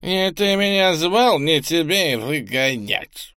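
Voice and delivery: put-on slurred drunk voice, slow and high; town drunk. A narrator's reading. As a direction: slur it like a drunk wench. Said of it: "заплетающимся пьяным голосом ответила девица". (заплетающийся пьяный голос)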